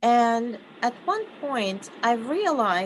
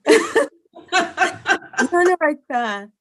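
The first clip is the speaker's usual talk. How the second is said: laughing through the words, slow; slow, laugh-filled reassurance; 6 semitones higher, 7 dB louder, much slower than usual. Retold or imitated. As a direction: breaks into a laugh mid-encouragement. Laughs while voicing encouragement.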